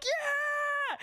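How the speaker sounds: high excited yell; group cheer. Retold or imitated. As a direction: shout it like the whole tube cheering. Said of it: "we're all like".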